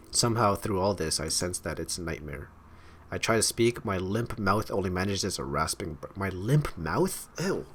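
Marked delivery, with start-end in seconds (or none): 6.1-7.6 slow, questioning repeat, grossed out; real grossed-out reaction, slow and rising; disgusted disbelief. Real, not acted. Breaks off reading, grossed out.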